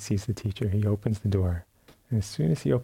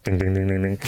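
quick ringing ding ding, louder; imitating a bell; 3 semitones lower, 3 dB louder, faster than usual. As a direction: ring it out like a little hand bell.

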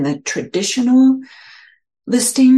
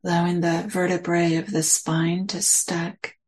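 guided meditation. Acted. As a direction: ease listeners into their posture, unhurried.

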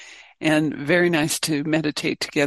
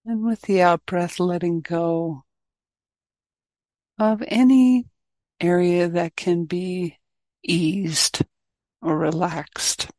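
guided meditation. Real, not acted. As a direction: guide the group gently inward, leaving space between phrases.

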